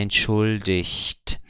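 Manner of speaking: entschuldigt very slowly, ending -icht; slow-motion entschuldigt, G as CH; ch sound for G. Shown as a demonstration. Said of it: "Só vale se for o I G sozinho".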